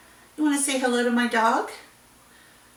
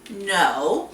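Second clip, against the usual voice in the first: normal talking voice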